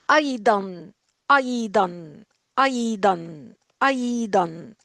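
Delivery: أيضًا with a clear final -an on each; tanween on أيضًا. Shown as a demonstration. Teacher drilling أيضًا for students, each one falling. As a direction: repeat أيضًا, falling, end in "an". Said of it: "this is not optional".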